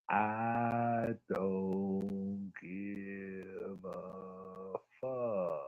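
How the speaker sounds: very slow, deep, drawn-out words; dragged-out slow-motion voice. Shown as a demonstration. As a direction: stretch every word in slow motion. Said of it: "I had to say it in slow motion".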